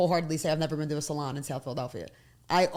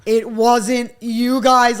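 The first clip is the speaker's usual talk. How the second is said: drawn-out plea; 4 semitones higher, 10 dB louder, much slower than usual. Retold or imitated. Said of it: "I'm like".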